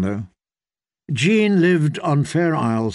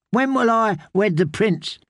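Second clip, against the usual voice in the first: put-on eager girl voice; 7 semitones higher than usual. Eager young girl asks.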